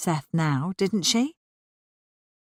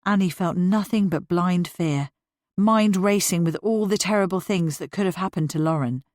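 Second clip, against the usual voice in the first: plain reading voice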